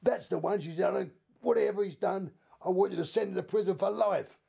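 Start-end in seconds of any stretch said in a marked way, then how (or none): 0.0-0.6 higher-pitched imitation of an irate woman; mock outraged accusation. Woman identifies him to police, imitated high indignation. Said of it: "she went absolutely apeshit". 0.8-4.4 high-pitched, worked-up demand; imitated furious woman. Woman demands police lock him up, imitated high-pitched outrage. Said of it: "she went absolutely apeshit".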